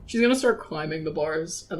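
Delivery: ordinary delivery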